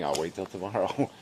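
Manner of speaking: normal talking voice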